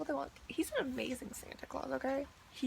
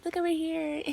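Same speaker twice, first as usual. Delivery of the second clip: higher, louder mock coaxing of a toddler; mock squealing; 4 semitones higher, 7 dB louder than usual. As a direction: imitate the boss squealing at a kid. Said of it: "squealing at the kid".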